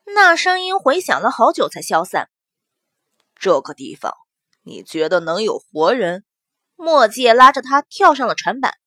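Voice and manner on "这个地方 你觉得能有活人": put-on low, dry older man voice; low man's voice. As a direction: voice him low and skeptical. Mo Jie character voice.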